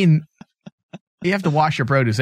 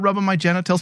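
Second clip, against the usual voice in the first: ordinary talk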